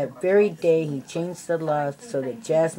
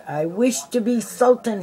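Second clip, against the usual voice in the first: higher and louder, a commanding demand; 6 semitones higher, 5 dB louder than usual. A narrator's reading. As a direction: insist on it like a tyrant. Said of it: "he demanded".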